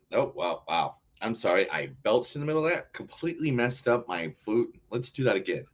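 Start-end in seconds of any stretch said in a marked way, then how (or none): none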